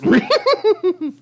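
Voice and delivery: very high put-on snickering laugh; cartoon dog snicker. Scooby's cartoon laugh in the bit.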